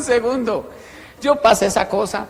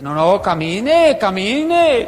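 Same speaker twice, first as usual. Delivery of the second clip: drawn-out, rising urging call; acted impatient goading; 5 dB louder, much slower than usual. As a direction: egg a reluctant friend on loudly. Boys urging him on, acted.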